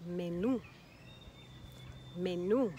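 menú landing on a strong NÚ; menú, light first syllable, stressed last. Shown as a demonstration. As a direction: hit the final "oo" of menú. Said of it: "we emphasize it".

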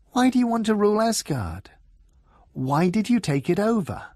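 ordinary delivery

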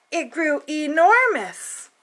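slow, drawn-out "enormous"; stretched enormous. A narrator's reading. Reader telling children how big it grew, drawn out. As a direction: stretch "enormous" to show size. Storybook emphasis on size.

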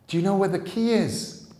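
high, loud and urgent; frightened girl at the locked gates; panicked question. Acted. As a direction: beg for the answer in a rush.

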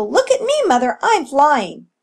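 proud pup shouting to mother; excited shout. A narrator's reading. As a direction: call out high with delight. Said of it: "shouted Echo".

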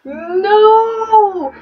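very high, long drawn-out no; high-pitched stretched cry of disbelief; shocked "no". Real, not acted. Player reacts to kiss revelation.

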